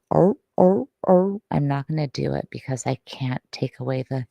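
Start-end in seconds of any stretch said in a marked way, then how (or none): 0.1-1.4 short, loud barking seal calls; seal sound imitation. Streamer copying a YouTuber's seal sounds, imitated barks. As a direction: bark like a seal, higher and louder. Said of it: "some arty guy goes".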